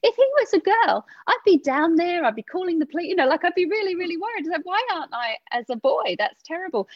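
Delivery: high-pitched anxious thoughts; worried inner voice. Retold or imitated. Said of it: "And I thought".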